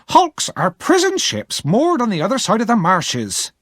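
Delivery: high-pitched, curt woman explaining; impatient explanation. Acted. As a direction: explain it as if he should know. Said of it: "Missus Joe explained impatiently".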